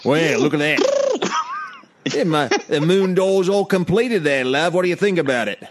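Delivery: put-on Cockney handyman; Cockney husband asking his wife; Cockney accent. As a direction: show off the work in mock Cockney. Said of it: "it's, it's Cockney".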